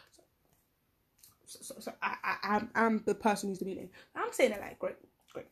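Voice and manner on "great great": soft whispered sarcasm; sarcastic whisper. Retold or imitated. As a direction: breathe it out drily.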